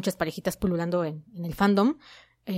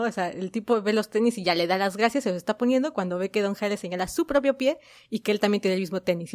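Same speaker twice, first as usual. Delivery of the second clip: ordinary talk, nothing marked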